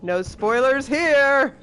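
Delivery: slow, loud sing-song tease; teasing. Real, not acted. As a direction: drag it out playfully. Teasing viewers about endings.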